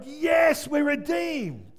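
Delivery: high, loud, joyful; jubilant cry. Retold or imitated. Preacher voicing the trees at the cry, jubilant shout. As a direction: burst out in relief. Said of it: "they were like".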